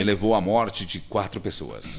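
low, solemn radio newsreader; grave news report. Shown as a demonstration. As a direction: read the tragic news low and serious. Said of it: "Um acidente".

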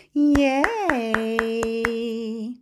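long-held, sing-out yay; cheer. Real, not acted. Host cheering babies after the song, drawn out. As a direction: stretch the cheer right out.

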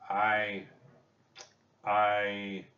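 halting, with long pauses; at a loss for words. A narrator's reading. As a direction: falter on each word, lost for an answer. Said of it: "seemed at a loss for words".

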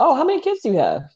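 put-on chirpy curiosity; mock nosy questioner. Mimicking people who ask her.

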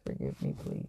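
breathy whispered apology; whispered plea. Real, not acted.

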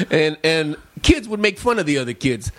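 ordinary talk, nothing marked

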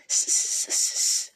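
repeated unvoiced sibilant hiss; creature hiss. A narrator's reading. Gollum frustrated by the question, hissing. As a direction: hiss in short snake-like bursts. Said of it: "hissed Gollum".

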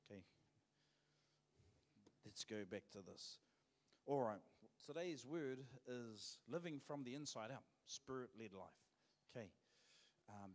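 ordinary talk

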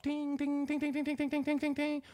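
high, rapid-fire ringing imitation; high repeated ting sound; imitated phone alert. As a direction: mimic a phone pinging over and over, pitch way up. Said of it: "My phone starts going off".

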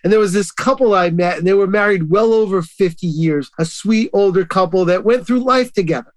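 ordinary delivery

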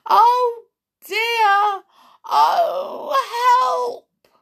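very high, loud cry for help; terrified cry. A narrator's reading. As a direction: wail in panic. Jack faces crocodile's teeth.

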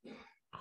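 trembling sigh, close to tears; breathy sigh, holding back tears; shaky sigh. Real, not acted. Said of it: "sorry, I'm trying not to cry".

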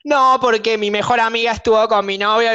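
high-pitched mock oversharer; desahogo imitado. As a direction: imitate a stranger launching into their drama. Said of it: "Ahora, me empezás a contar". (imitando un desahogo dramático, agudo)

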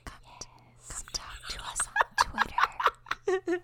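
slow breathy whisper, stifling a laugh; ASMR whisper. Pretend. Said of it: "those A S M R videos".

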